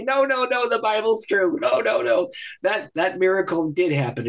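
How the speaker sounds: high-pitched, laughing insistence; laughing protest. Retold or imitated. Student laughingly insisting to his professor that the Bible is true.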